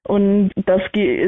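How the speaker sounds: usual speaking voice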